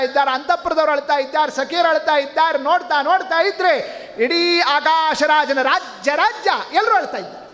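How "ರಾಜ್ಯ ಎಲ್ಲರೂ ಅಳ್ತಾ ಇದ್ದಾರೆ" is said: high, building cry on ಎಲ್ಲರೂ; rising lament. Acted. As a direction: peak of the list, ಎಲ್ಲರೂ pitched up high. Building list of all weeping.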